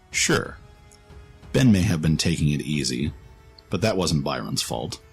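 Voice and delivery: ordinary delivery, nothing marked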